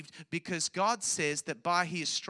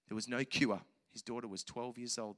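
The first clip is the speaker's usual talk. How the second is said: ordinary talk, nothing marked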